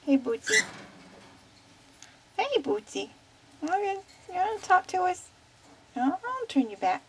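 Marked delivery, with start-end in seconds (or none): 0.0-5.3 higher, sing-song call to the parrot; lilting coaxing call for the bird to copy. Shown as a demonstration. Owner modelling the phrase for her parrot, higher sing-song. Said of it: "Say, "Hey, Bill".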